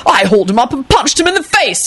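high, self-satisfied boast; smug. A narrator's reading. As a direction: boast to the crowd. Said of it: "he announced smugly".

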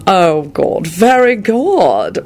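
high, very drawn-out sarcastic praise; intoned, oozing sarcasm. A narrator's reading. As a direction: stretch the words in mock approval. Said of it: "Malfoy intoned sarcastically".

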